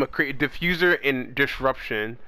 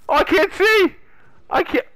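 panicked shout; 6 dB louder than usual. Real, not acted. Blinded in game.

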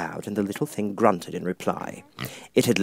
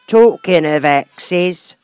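very slow, loud, higher bossy duchess; 7 semitones higher, 11 dB louder, much slower than usual. Acted. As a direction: drag it out threateningly.

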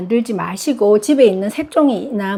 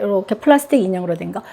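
ordinary talk, nothing marked

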